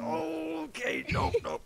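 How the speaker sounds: high, strained mock groan of pain; hammed-up hurt reaction; acted pain. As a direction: groan it as if you just landed hard. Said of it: "and it hurts".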